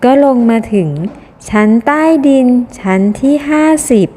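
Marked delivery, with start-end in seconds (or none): none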